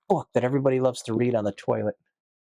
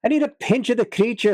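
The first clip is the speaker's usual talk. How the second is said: higher, louder mock bar patron; a hammed-up regular begging a pinch of snuff; imitating bar patron; 8 semitones higher, 3 dB louder than usual. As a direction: ham up the old regular asking for snuff. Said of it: "That's what he would say".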